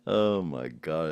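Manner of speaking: ordinary talk, nothing marked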